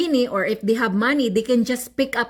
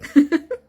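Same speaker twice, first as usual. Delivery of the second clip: real amused chuckle; brief higher-pitched laugh at your own remark; short laugh; 6 semitones higher, 4 dB louder than usual. Real, not acted. Storyteller amused at her own once-upon-a-time aside, chuckling.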